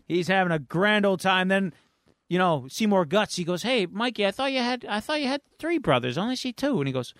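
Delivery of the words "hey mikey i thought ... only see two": high-pitched cartoon mutant voice; put-on character voice. Storyteller as mutant guest questioning Mikey, high put-on voice. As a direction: play a puzzled guest in a high voice. Voices Seymour Guts at party.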